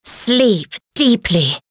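slow, drawn-out words; slow lulling command. Acted. Casting a sleep spell.

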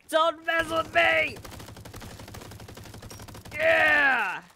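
high, loud, drawn-out taunt; mock battle cry. Freaking crab with a gun to enemies, mock battle cry. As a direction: yell it like a tiny armed warrior.

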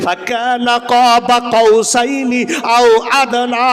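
slow, loud chanted Arabic recitation; Quran recitation. Acted. Preacher reciting the Quranic verse to the audience, slow and loud chant. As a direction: intone the verse slowly and loudly.

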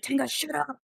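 hissed shout kept under breath; whisper yelling. Acted. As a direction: yell it, but in a whisper. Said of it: "I'm a whisper scream".

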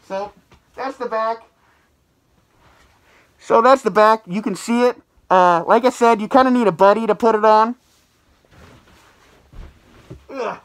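speaking inside full head mask; through a face mask. Real, not acted. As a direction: voice muffled by mask. Seen 3: Speaker wears full head mask throughout; R frames hold it unworn.

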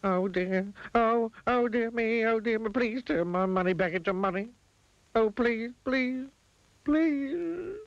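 high, wailing mock plea; distraught pleading. Mister Mean begging the wizard for his money, distraught. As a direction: beg frantically, pitched high.

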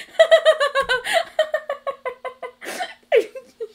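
loud, high burst of laughter; long helpless laughing fit. Real, not acted. Overwhelmed by singing in video.

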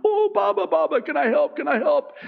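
put-on very high little-kid voice; eager young child. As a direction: imitate an adorable toddler begging to help. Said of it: "how cute your kids are".

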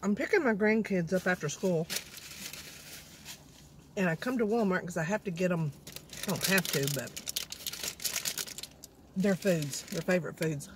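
chewing between words; eating while talking; talking with mouth full. Real, not acted. Seen 3: Clear face; chewing and biting wrap while speaking throughout the clip.

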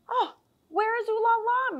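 frantic. Acted. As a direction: cry it out like a panicked mother. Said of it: "Missus Poodle asked frantically".

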